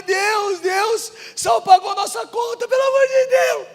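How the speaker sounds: high, sobbing outburst of amazement; tearful cry, re-enacted. Son telling family the bill is paid, crying. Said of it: "Comecei a chorar".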